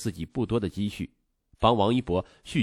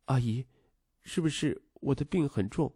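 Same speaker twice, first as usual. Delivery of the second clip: put-on soft, slightly raised child's voice; timid question; 4 semitones higher, 4 dB quieter than usual. A narrator's reading. Sick boy asks his stepmother about his illness, timidly. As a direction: ask timidly, afraid of the answer. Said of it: "他怯怯地问".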